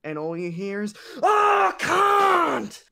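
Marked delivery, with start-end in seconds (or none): 1.2-2.8 high, full-volume rage scream; losing his shit. Retold or imitated. Streamer dying in Fortnite on a kid's iPad, screaming. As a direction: scream it like you just lost the game. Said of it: "died in Fortnite and lost my shit".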